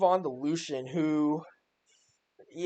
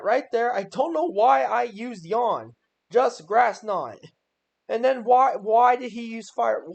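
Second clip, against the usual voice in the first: higher, louder, slowed frustration at himself; exasperated outburst; 7 semitones higher, 6 dB louder, slower than usual. Real, not acted. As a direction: groan out the disbelief at your own move. Exasperated at own replay mistakes.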